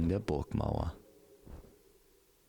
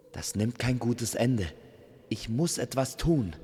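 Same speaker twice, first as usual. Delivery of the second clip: raised, urgent resolve; urgent alarm; 5 semitones higher, 3 dB louder than usual. Acted. Amor drawing his bow to intervene, urgent. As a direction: speak up in alarm, ready to act.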